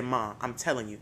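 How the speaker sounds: hushed insisting. Retold or imitated. Urging mom at night.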